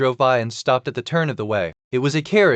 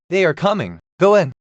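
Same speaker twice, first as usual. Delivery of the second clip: urgent call; 5 semitones higher, 4 dB louder than usual. A narrator's reading. Crowd urging him in.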